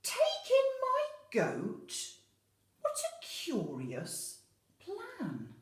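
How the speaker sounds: high, loud, laughing disbelief; baffled old woman questioning the plan; puzzled old lady. Acted. Old lady doubting the wise man, high puzzled voice. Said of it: "old lady reply".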